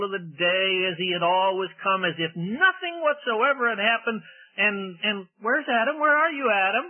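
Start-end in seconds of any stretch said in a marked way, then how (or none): 5.4-6.8 high, searching call. Pretend. God calling for hiding Adam, high innocent call. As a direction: call through the garden as if unaware.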